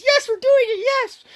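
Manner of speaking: high, loud gleeful squeal; excited squeal. Retold or imitated. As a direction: squeal it like jumping for joy. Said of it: "jumps up and down and says".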